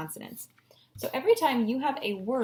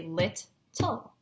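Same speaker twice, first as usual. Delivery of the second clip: LIT-TLE with a clear gap, lit, tull; each chunk of LIT-TLE said separately; split into syllables. Shown as a demonstration. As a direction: break LIT-TLE in two. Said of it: "break up little into its syllables".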